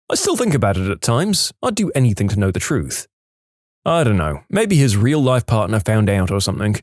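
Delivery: usual speaking voice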